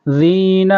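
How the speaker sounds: ذین with dental ذ; clear zaal. Shown as a demonstration. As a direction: voice the ذ of ذین between the teeth. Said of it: "یہاں پر ذال ہے،".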